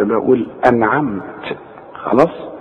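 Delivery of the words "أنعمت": "أنعمت" softer, ending on bare t; silent-vowel stop. Shown as a demonstration. Teacher shows pausing, softer "أنعمت" ending on bare t. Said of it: "وقفت بسكن الحرف".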